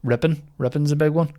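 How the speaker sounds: ordinary talk, nothing marked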